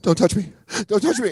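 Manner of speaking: hammed-up terror, backing away; horror movie freak out. Friend acting the horror-movie victim, panicked high shriek. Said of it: "full on horror movie freak out".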